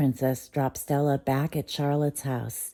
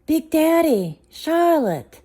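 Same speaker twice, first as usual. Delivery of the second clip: much higher, louder welcome; joyful greeting; 13 semitones higher, 8 dB louder, slower than usual. Acted. Hostess happily greeting arriving friends, high and loud. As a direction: sing out their names with delight.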